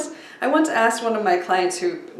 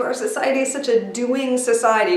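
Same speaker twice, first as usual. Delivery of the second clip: normal talking voice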